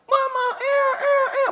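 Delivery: put-on very high whimpering baby voice; baby crying. Imitating a newborn crying for mama, very high.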